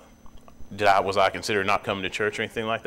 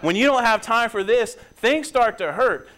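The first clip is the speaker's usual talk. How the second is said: same words pitched higher and slower; rising preacher emphasis; 10 semitones higher, 6 dB louder than usual. Acted. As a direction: lift it into a preaching cry. Pastor raises pitch repeating line.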